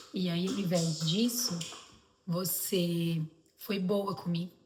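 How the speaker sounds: slow, measured retold confession of a child. Son confesses to mother he faked being sad, slow halting confession. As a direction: draw it out with pauses, imitating a child owning up.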